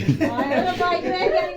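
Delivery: laughing. Real, not acted. Laughing exclamation at kids.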